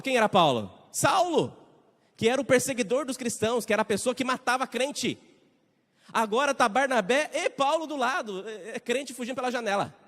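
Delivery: loud, high, rising intensity; excited preaching. Real, not acted. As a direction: preach it with building fire. Dramatic build about Saul.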